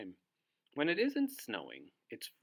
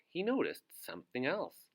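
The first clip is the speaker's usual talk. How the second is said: ordinary delivery, nothing marked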